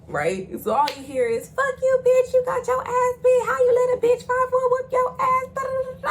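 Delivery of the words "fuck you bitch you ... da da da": high, loud taunting yell; shrieking victory taunt; trash-talk yell. Retold or imitated. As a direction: gloat at her at full volume. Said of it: "I start talking my motherfucking shit".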